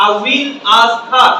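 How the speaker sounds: "I will ask her" said slowly, each word separate; slow learner pronunciation. Shown as a demonstration. Teacher shows students the learners' way, slow word-by-word "I will ask her". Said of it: "আমরা একরকম উচ্চারণ করি, ওরা আরেক রকম".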